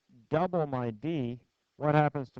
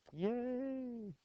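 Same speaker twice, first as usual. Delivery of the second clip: mock cheer; 10 semitones higher, 9 dB quieter than usual. Teacher cheers right answer.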